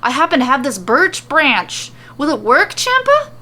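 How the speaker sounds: hammed-up excited shopkeeper; excited offer. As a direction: pitch soaring on the name. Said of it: "begins speaking excitedly".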